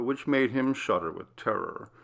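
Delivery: plain reading voice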